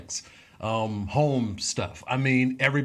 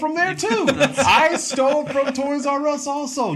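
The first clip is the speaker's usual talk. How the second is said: gleeful boast; 5 dB louder than usual. Real, not acted. Gleeful boast about stealing.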